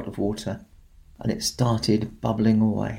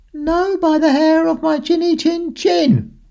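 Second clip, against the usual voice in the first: much higher, louder little pig voice; high squeaky pig; 5 dB louder than usual. A narrator's reading. Little pig refuses the wolf at the door, squeaky high.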